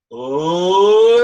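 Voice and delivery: long loud oh sliding up in pitch; sustained rising oh, chin tucked; chin in, sound goes up. Shown as a demonstration. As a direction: tuck the chin and let a rising oh swell. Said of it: "chin down ... chin in".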